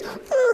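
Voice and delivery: single very high yelp; high-pitched struggling cry. Acted. Winter unable to free his hand, struggling yelp. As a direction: strain and cry out, stuck.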